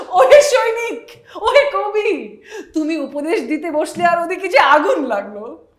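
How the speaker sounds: very high, loud, slow, laughing declamation; hammed-up stage call breaking into laughs; jatra dialogue. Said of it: "তুমি ওভাবে বলো".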